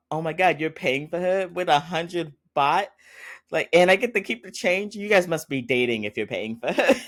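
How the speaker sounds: high-pitched mock disbelief, imitating a character; hammed-up astonishment. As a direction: exaggerate the shock, pitch jumping up. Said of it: "she's acting right now".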